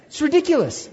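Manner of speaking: high, loud exclamation of disbelief; loud, high-pitched exasperated amusement; incredulous outcry. Real, not acted. Preacher exclaims at the absurd scene.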